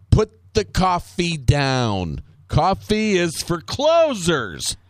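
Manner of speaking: hammed-up hard-boss command, drawn out; Alec Baldwin. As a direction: spell it out slowly to a slacker. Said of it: "he goes".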